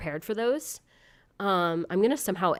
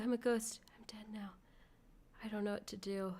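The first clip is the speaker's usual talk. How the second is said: soft breathy ghost whisper; ghostly whisper; 3 semitones higher, 9 dB quieter than usual. Pretend. To chat, quoting her ghost song in whisper. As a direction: whisper it like a lost little ghost. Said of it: "it's just like my Ghoul Boy song".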